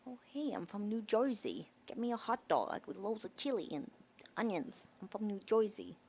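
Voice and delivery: hammed-up Jersey "Joizy" accent; New Jersey accent. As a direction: play a cartoon New Jersey local. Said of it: "Can you do a New Jersey accent".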